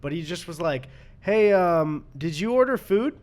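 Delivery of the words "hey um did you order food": imitating delivery guy. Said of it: "He had some kind of accent".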